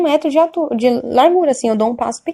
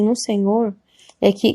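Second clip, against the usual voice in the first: normal talking voice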